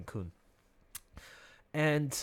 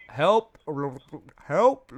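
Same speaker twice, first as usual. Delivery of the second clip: louder, high rising calls of help; calling for help; 4 semitones higher, 11 dB louder than usual. Retold or imitated. Drowning swimmer calling to friends across pool, loud call. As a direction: cry out for rescue from the water.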